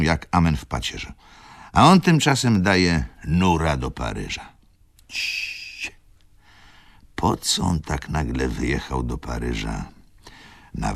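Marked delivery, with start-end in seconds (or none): 5.1-5.9 whispered "Cii"; hushing whisper. A narrator's reading. Rzecki hushes himself mid-rant, whispering. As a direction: hush yourself.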